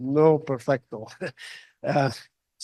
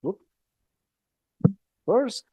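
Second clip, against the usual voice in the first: ordinary delivery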